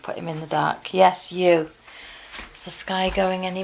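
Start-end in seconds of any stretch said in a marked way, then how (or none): 0.9-1.7 loud pointed telling-off; scolding dog. Real, not acted. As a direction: call out the dog.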